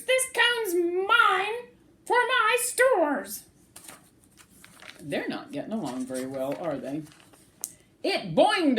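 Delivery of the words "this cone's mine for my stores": very high, slow squirrel voice; greedy claim. Acted. Bruce claiming the cone for his stores.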